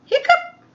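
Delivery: a sudden high squeaky hiccup sound; loud hiccup. Acted. Elephant hiccups, a high loud hiccup. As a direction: pop out a big comic hiccup.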